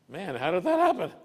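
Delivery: high, loud amazed exclamation, laughing; amazed board member. Retold or imitated. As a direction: act out a stunned board member, half laughing.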